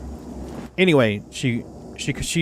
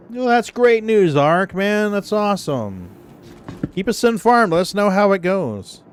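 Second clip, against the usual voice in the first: excited; 7 semitones higher than usual. Real, not acted. Congratulating friend.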